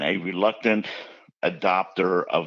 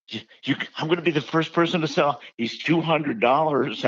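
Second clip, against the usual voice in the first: higher and fast, a lightly played eager shop girl; mock excited clerk; 8 semitones higher than usual. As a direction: rush it out like a thrilled young clerk. Zoo clerk voiced in retold story.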